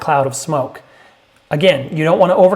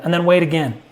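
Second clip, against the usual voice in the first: normal talking voice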